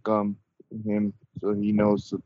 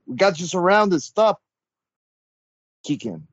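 threatening shout; 8 semitones higher than usual. Acted. Player threatens prisoner.

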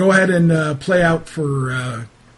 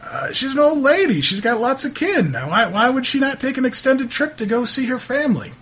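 higher-pitched, protesting character voice; flustered, higher-voiced townsman fending off blame; defensive local man; 8 semitones higher, faster than usual. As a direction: brush off the questions, pitched up and defensive. Game master plays irritated local.

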